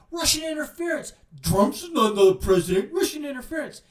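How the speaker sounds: high, mocking chant of the Democrats; mocking whine. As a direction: parody them as a whiny chorus, voice pitched high. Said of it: "kept saying".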